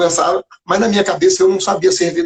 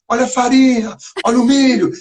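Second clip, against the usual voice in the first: higher-pitched mock market yell; imitating vendors yelling; 8 semitones higher than usual. Market vendors hawking flour and corn, mock yell. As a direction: call out the goods like a stall vendor, lightly. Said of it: "eles gritavam".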